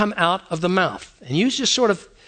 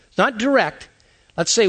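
ordinary delivery

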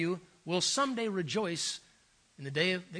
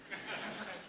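a breathy laugh under the story; amused chuckle mid-anecdote; soft unvoiced chuckle; 14 dB quieter than usual. Real, not acted. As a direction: let a quiet laugh slip out. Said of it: "teller laughing at story".

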